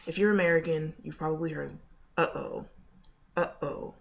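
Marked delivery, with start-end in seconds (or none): 2.2-4.0 "uh-oh" with a clean glottal stop between uh and oh. Shown as a demonstration. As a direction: cut the air off hard in the middle of "uh-oh". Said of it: "Do you hear the consonant sound between uh and o".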